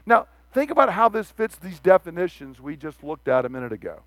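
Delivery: ordinary delivery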